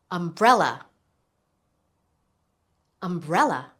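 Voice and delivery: "Umbrella" stressed on the middle part, BREL; stress on second syllable. Shown as a demonstration. Teacher letting learners hear the stress, "Umbrella" stressed on the second syllable. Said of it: "It's on the second syllable".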